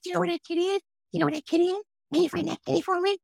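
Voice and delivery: put-on high, quick baby-talk to the dog. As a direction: coax the dog like a toddler, high and bright. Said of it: "now I'm talking to her dog".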